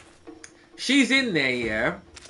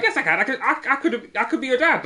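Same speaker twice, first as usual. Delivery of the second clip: mock insistence; 7 semitones higher, faster than usual. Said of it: "So he's like".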